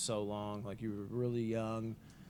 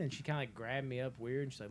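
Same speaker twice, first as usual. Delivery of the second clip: normal talking voice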